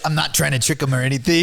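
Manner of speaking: high mocking echo of the host; teasing mimicry. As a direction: mimic him with a sly grin. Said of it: "When your hands are like this".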